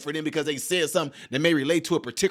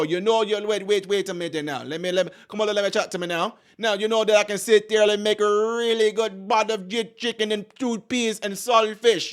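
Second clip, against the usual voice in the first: higher Jamaican patois, lightly done; mock Jamaican patois; 4 semitones higher than usual. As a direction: sweet-talk in a hint of a Jamaican accent. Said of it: "She don't get around Jamaicans and go".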